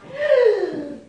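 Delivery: loud breathy imitated noise; a big breath as an unseen beast; imitated animal sound. Shown as a demonstration. Storyteller shows the sound the mouse heard, loud breath. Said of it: "And it went like this".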